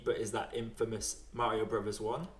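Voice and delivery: ordinary delivery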